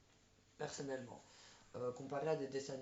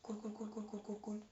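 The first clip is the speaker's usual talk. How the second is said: higher, quick repeated cool like the cartoon character; imitating the cartoon's lead; 6 semitones higher than usual. Viewer mimics the film's lead character, higher repeated cools. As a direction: bounce through the cools as the animated hero.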